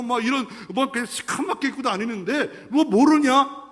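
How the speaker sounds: high, mocking scolding voice; playfully scolding rebuke; Jesus scolding. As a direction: talk down to him like a smug teacher.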